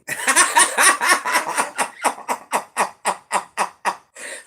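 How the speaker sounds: long, high, loud laughing fit; laughing hard at a chat comment. Real, not acted. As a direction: let a big high laugh roll. Laughing at cheese twang comment.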